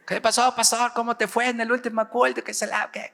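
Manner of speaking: high, eager hello to the pastor; saludo telefónico efusivo. Retold or imitated. Acts his cheerful phone greeting. (saludo agudo y efusivo por teléfono)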